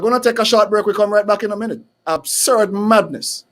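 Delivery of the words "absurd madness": ordinary talk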